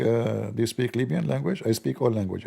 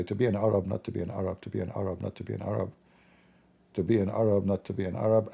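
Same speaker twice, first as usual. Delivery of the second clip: a hint of a brooding poet, softer; pondering Shakespeare; 4 semitones lower, 7 dB quieter, faster than usual. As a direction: muse to the sky, hushed and lingering. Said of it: "Shakespeare was saying".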